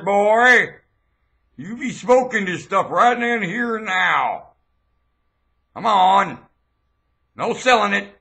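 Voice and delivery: threatening shout. Acted. Self-proclaimed monarch threatening gang members, angry shout. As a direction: bark the threat at them like a furious enforcer.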